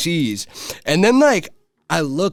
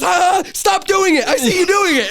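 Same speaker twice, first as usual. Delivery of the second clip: mock accusing shout; 3 dB louder than usual. Rapper catches his tripping friend again, mock accusing. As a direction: imitate a friend shouting, way up in pitch.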